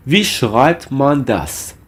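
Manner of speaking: slow, measured German for learners; slow model phrase. Shown as a demonstration. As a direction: model the phrase slowly, word by word. Teacher models German question for learners.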